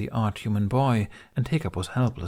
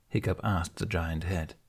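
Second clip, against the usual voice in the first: ordinary delivery, nothing marked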